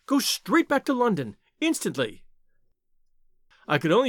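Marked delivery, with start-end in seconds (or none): 0.1-2.2 high-pitched urgent plea; urgent warning. A narrator's reading. Woman sending a stranger back to London, urgent. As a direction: press him to leave, voice raised in pitch.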